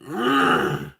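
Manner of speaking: loud fed-up grunt in a changed voice; dog grunt. Retold or imitated. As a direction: grunt like a dog losing patience. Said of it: "and he's just".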